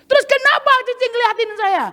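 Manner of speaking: high, tearful-sounding mock tattling; hammed-up complaining girl; whiny complaint. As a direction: imitate her running to the boss. Dancer demands explanation.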